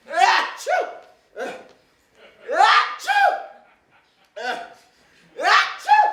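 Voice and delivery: escalating sneezes. Acted. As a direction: build the sneezes up big.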